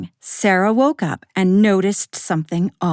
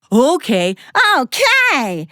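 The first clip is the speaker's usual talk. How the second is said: louder, rising to high delight; excited okay; 7 semitones higher, 5 dB louder than usual. Acted. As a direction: agree with growing glee. Monsters agree eagerly.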